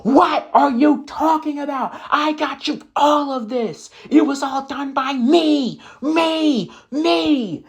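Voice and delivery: high, strained angry rant as the husband; mock furious rant, pitched high. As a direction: hurl it at her, accusing. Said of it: "he was furious now".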